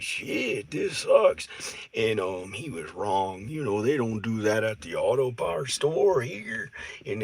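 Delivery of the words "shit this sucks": high complaint. Retold or imitated. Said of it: "he was like".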